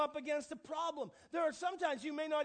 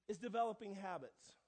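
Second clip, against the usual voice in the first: ordinary talk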